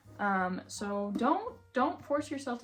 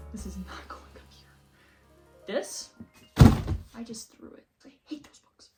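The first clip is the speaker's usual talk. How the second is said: whispering; 6 dB louder than usual. Real, not acted. Aside while stacking books falls.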